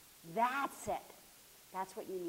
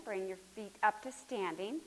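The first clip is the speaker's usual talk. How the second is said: ordinary talk, nothing marked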